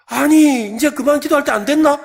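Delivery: high, loud annoyed question; fed-up friend. Acted. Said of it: "이게 담대한 기도라는 거예요". (지친 친구가 높고 크게 따지듯)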